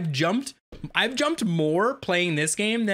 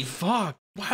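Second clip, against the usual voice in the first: ordinary delivery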